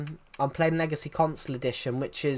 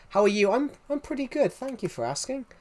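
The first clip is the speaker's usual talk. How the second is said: chirpy reply; 9 semitones higher than usual. Real, not acted.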